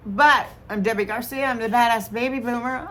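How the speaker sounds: ordinary delivery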